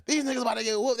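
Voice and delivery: put-on high, taunting voice of the girls; mocking girls. Girls at the fight taunting, high mocking voice. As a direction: sneer it like a bystander who expects a beating.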